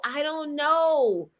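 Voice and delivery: high, drawn-out mock whine; whiny child, imitated. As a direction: drag it out like a whining kid. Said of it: "causes them to be whiny".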